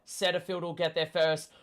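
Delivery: ordinary talk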